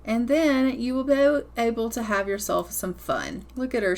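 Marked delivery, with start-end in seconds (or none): none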